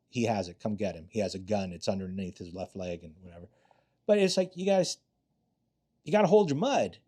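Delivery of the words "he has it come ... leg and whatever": softer, low phone report; hushed call. Retold or imitated. Cop quietly phoning backup about the armed dealer, hushed. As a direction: keep it down so the dealer can't hear. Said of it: "And I like".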